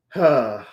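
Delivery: a long, weary sigh out; heavy sigh. Real, not acted. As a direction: let the air out, defeated. Deflated fan after rant.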